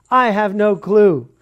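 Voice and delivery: loud and drawn out, amused; a preacher admitting he doesn't know his plans, loudly and slowly; loud, slow admission. Real, not acted.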